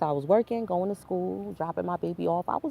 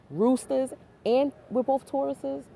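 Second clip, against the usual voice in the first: emphatic repeat; 8 semitones higher than usual. Real, not acted. Repeats the sign line, emphatic.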